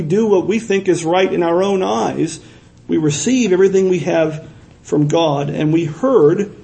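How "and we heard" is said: heard slowed and leaned on; stress on heard. Shown as a demonstration. Preacher stressing heard to the congregation. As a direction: draw out heard, slowly. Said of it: "emphasis on heard".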